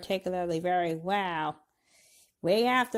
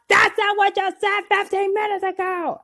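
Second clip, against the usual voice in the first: loud, high protesting shout; shouting at Thranduil's hypocrisy; indignant outburst; 10 semitones higher, 6 dB louder than usual. Retold or imitated. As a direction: call out the hypocrisy. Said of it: "And I thought".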